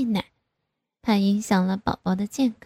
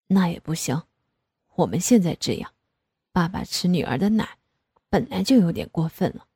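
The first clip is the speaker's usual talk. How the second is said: father's voice; 3 semitones lower than usual. Acted. Father voiced scolding playfully.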